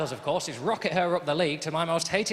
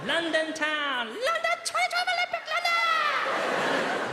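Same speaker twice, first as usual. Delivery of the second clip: put-on excited child; slower than usual. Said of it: "child excited chant".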